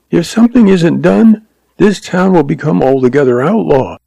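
high, earnest, worried woman's voice; anxious frontier woman. Acted. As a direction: plead it as a worried older sister, pitched high. Voices Lois, desperate plea.

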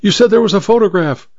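high, quick insistence; urgent cry. A narrator's reading. Housemaid pressing about the promised photograph, urgent cry. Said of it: "cried the housemaid".

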